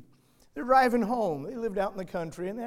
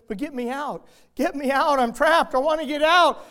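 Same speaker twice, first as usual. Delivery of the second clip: put-on a trapped child begging, getting more desperate with each line; trapped and pleading; 9 semitones higher, 11 dB louder than usual. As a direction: voice climbing higher and louder as panic builds. Trapped girl calls to father.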